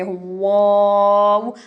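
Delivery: वाव drawn out very slowly with rounded lips; rounded vav. Shown as a demonstration. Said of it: "होंठों को गुलाई देनी है". (होंठ गोल करके वाव को खींचकर)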